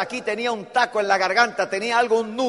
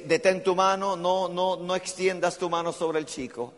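a lower, softer angel's voice; angel speaking; 5 semitones lower, 5 dB quieter than usual. Acted. Angel stopping Abraham's hand, lower and softer. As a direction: stay the father's hand gently. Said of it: "Y le dice".

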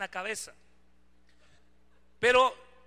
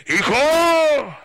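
huge, drawn-out wake-up yell; papá despertando; 6 semitones higher, 17 dB louder than usual. Retold or imitated. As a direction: bellow it high and long to jolt a sleeper awake. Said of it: "tu papá te despierta así". (grito exagerado de papá para despertar al hijo)

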